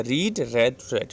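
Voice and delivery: रीड with long ee, then रेड short like red; read versus red. Shown as a demonstration. Teacher contrasts both sounds for class, रीड then रेड. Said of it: "pronunciation है ये अलग हो जाता है".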